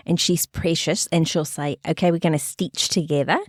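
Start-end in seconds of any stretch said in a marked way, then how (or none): 1.9-3.4 imitating Australian lady. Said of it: "this lady from Australia".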